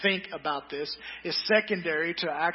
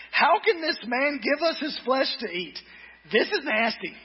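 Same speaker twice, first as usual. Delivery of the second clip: higher, scandalised mock protest; a hint of a disgusted grumbler; mock indignant crowd; 8 semitones higher, 3 dB louder than usual. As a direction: toss it off like an outraged listener. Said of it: "began to argue sharply".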